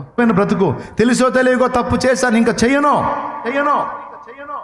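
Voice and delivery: desperate plea. Acted. Addict voiced pleading to God.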